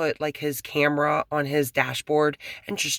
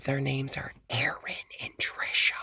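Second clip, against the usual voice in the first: hushed so the neighbor can't hear; secretive whisper about the neighbors; 6 dB quieter than usual. Real, not acted. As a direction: whisper it, he's right outside. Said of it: "I can't really talk loud".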